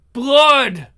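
a sudden hungry shout; loud. Acted. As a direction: bark the word out, high. Hungry demon shouts for blood.

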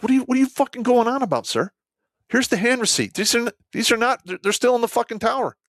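high-pitched, agitated retold comeback; exasperated retort. Gunny answering his freaking-out commander about the tower, exasperated retort. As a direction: fire back in heated disbelief.

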